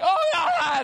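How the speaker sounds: hammed-up panicked squeal at the roach; mock childish scream. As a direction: shriek like a scared little girl, half laughing. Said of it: "scream like a small child".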